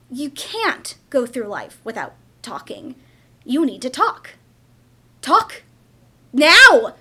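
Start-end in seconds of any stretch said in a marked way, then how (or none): 5.2-7.0 put-on sudden angry shout at the child; furious shout. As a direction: yell it, loud and sharp. Mother yelling at silent daughter.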